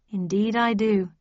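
ordinary delivery

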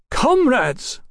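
high, loud sing-out, sharp as an iced razor; icy call-out. A narrator's reading. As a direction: call the room to order, cold and cutting. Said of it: "Bartlett sang out in a voice like an iced razor".

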